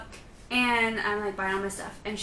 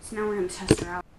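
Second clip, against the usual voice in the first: normal talking voice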